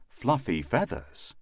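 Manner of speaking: usual speaking voice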